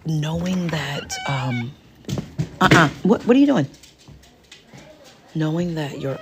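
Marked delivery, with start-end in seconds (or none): 2.6-3.7 loud, high startled scold; scolding shout. Real, not acted. Scolding kids nearby.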